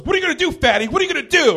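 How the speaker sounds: loud, high mock taunt; douchebag. Comic mimics the guy coming over to challenge him, loud douchebag taunt. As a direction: goad him like an obnoxious bro. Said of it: "He starts doing the whole douchebag thing".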